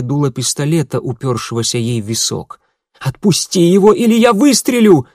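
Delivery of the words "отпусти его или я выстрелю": high, loud shout; shouting threat. A narrator's reading. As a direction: scream the threat with a gun raised. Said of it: "закричала Едвига". (громкий крик с угрозой)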